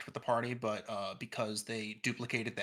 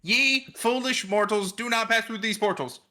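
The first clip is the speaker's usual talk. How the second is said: higher, louder, shouted warning; loud repeat; 8 semitones higher, 11 dB louder than usual. Acted. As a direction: belt it across a bad connection. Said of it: "I cut out after you said axe".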